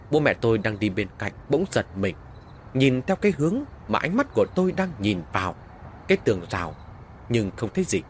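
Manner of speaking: plain reading voice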